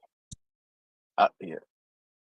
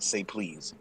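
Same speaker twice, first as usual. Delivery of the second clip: much softer, low and pointed; quiet menacing demand; 3 semitones lower, 13 dB quieter than usual. Retold or imitated. Pearce toying with Heyman, soft cold demand. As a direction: drop it to a soft, cold order.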